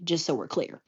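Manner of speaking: ordinary talk, nothing marked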